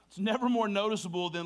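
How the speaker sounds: ordinary delivery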